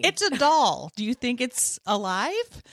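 loud, high correction; acted exasperated therapist; mock disbelief. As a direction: state the obvious, loudly incredulous.

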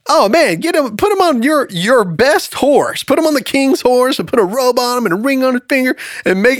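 high-pitched, excited pitch to the king; eager acted Haman. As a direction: gush out your grand idea, voice jumping up. Acts out Haman's eager proposal.

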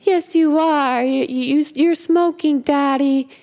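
put-on high, drawn-out child's insistence; crying little girl. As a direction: imitate an upset girl pleading with Daddy. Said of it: "she's crying and she's crying".

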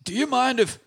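high, quick pleading voice; repeating the request in a squeaky character voice; pleading sidekick. Acted. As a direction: beg again for the telescope. Character asks again.

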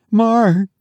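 high, mildly anxious wife voice; shaky. A narrator's reading. Wife asking husband about their son, shakily. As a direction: ask him timidly, pitched high. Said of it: "she asked shakily".

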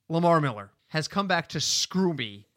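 ordinary delivery

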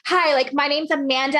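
put-on bubbly, lively teen cheerleader intro; sassy cheerleader. Shown as a demonstration. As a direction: sell it like a peppy eighteen-year-old. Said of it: "eighteen years old, sassy, loud, cheerleader, go".

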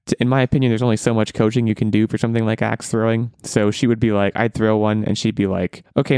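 ordinary talk, nothing marked

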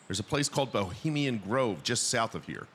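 ordinary delivery

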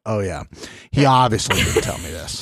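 low, casual mock afterthought; mock offhand jab. Imitating her walking back past Mariah, offhand jab. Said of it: "came back and was like".

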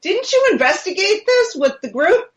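high, singsong imitation of a husband; husband's alarmed call. Husband calling to his wife about the TV show, high mock alarm. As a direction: mimic him calling out, pitch swooping up. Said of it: "I hear him say".

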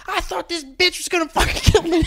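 high, loud, laughing outburst; hammed-up panic at the police; imitating frantic manager. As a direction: manager telling police he feared for his life, high and laughing. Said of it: "I can hear him".

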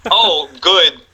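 lightly voiced Walken, clipped; a hint of Walken's halting delivery; Christopher Walken impression. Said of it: "Oh, I see what you did there".

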